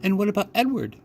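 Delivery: put-on high, uncertain little girl's question; high uncertain child. A narrator's reading. Girl at dinner asks about her rabbit, high and unsure. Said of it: "her voice high and uncertain".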